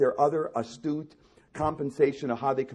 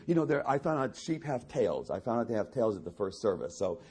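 normal talking voice

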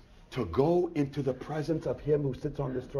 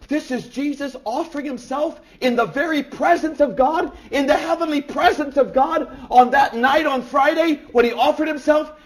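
high, loud, driving proclamation; full-force preaching; 13 semitones higher, 11 dB louder than usual. Real, not acted. Preacher proclaiming Christ's offering, full force.

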